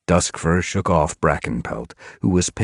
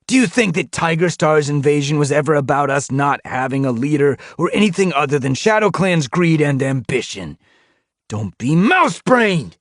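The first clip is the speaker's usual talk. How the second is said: much higher, louder scornful challenge; snarl; 13 semitones higher, 6 dB louder than usual. A narrator's reading. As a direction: snarl it in her face. Continues snarling rant.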